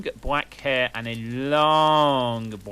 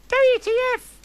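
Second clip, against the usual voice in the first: squealed very high and loud; hammed-up high-pitched shriek; shouted; 7 dB louder, faster than usual. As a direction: shriek the letters in outrage. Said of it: "I shouted".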